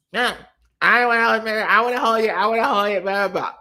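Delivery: mock whiny toddler, loud and insistent. Comic imitating the toddler refusing again, loud whine. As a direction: act out a stubborn little kid.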